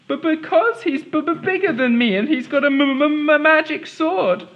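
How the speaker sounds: high nervous stammering king. Acted. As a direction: stammer out the excuse.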